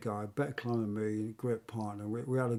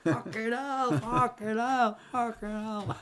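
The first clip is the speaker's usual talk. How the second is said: mock panic; 10 semitones higher, slower than usual. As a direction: imitate a climber panicking under rockfall. Acted reaction to falling rocks.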